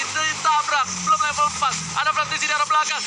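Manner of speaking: esports caster. Acted. Hyped play-by-play call.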